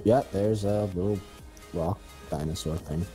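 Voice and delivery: ordinary talk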